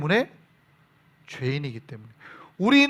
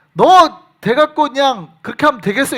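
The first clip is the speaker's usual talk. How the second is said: louder, higher mock scolding; raised-voice mock rebuke; 5 semitones higher, 8 dB louder than usual. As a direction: tell them off like an angry parent. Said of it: "조금 나쁘게 들리면".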